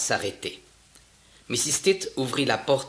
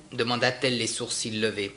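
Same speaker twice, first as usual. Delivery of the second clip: ordinary delivery, nothing marked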